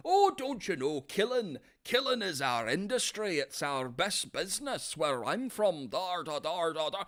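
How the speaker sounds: high, lilting mock-Irish villain; Irish accent. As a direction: put on a hammed-up Irish accent.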